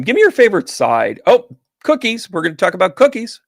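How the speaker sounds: loud, high burst of delight; real excitement. Real, not acted. Host spotting cookies in the chat, excited.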